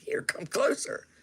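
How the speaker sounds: high whispered invitation. Retold or imitated. Luring the gang member close, whispering. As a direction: whisper it to draw him near. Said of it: "And I was like".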